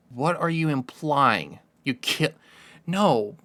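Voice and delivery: ordinary talk, nothing marked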